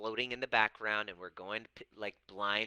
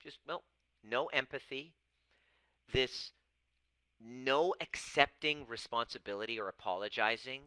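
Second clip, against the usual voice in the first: usual speaking voice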